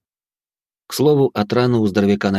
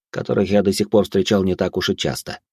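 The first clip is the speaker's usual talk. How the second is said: ordinary delivery, nothing marked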